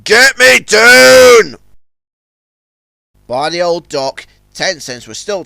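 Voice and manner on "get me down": slow, loud desperate shout. Acted. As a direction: demand it furiously. Stuck on barge.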